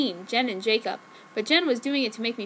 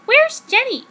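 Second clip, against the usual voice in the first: sharp high suspicious question; high pointed question; 11 semitones higher, 7 dB louder than usual. Acted. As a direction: demand it, full of suspicion. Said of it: "I asked him suspiciously".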